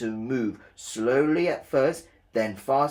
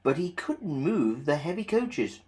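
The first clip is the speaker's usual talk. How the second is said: ordinary delivery, nothing marked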